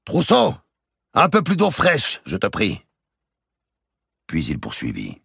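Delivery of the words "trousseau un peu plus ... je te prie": high, loud call to the servant; king shouts for more water. Acted. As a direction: call across the bathhouse. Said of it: "Il cria à son chambrier".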